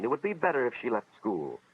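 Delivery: plain reading voice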